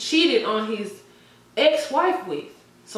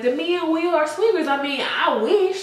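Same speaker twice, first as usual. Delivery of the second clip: mocking Jada; 8 semitones higher than usual. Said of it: "she was like".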